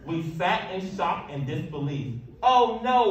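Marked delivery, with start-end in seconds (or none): 2.4-3.1 loud, high anguished oh no; moaning. A narrator's reading. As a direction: wail it in dismay. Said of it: "we moaned".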